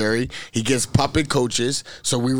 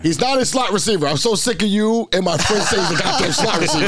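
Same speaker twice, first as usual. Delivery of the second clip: fired up, defending his player; escalating comedy rant; 7 semitones higher than usual. Real, not acted.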